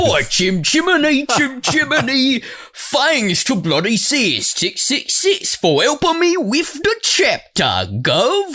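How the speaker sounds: high, slow, hammed-up wannabe Cockney accent. Co-host rereads author's note, hammy fake Cockney accent. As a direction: lay on a bad Cockney, stretched and singsong.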